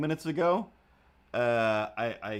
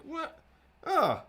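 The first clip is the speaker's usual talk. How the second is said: surprised; 3 dB louder than usual. Retold or imitated. Realizes shirt mistake.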